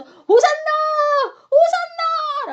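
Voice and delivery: high, loud, long-held cheer; crowd shout. Acted. Crowds following Jesus through the streets, high ringing shout. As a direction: call it out across a whole city. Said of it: "라고 외치자".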